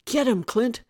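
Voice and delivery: loud, high urgent command; shouted. A narrator's reading. Mike to Clint over the microphone, shouted. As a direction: shout the order across the lot. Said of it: "Mike shouted into the microphone".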